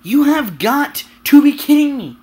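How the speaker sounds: real gamer rant building to disbelief; angry rant. Real, not acted. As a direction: vent it out, fed up and loud. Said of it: "So that was my rant".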